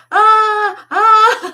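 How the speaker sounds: high, loud fake scream; mock screaming. Janitor scaring the kid with his trick, high loud fake scream. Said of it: "he's like".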